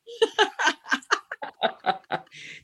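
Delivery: high burst of laughter; real laughter, high and free; big real laugh. Real, not acted. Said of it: "And you just got that?".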